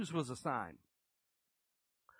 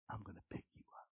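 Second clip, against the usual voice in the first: fast, much softer, breathy whisper of God's promise; 2 semitones lower, 14 dB quieter, faster than usual. Pretend. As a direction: hush it like a secret promise.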